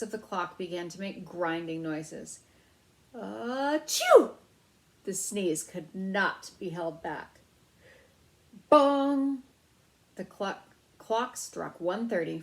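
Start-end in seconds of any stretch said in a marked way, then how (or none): 3.1-4.3 loud high sneeze bursting out. A narrator's reading. Mouse sneezing under the clock, loud high sneeze. Ralph's held-back sneeze. 8.7-9.4 loud high ringing bong; clock bong. A narrator's reading. Clock striking over the mouse's head, loud bong.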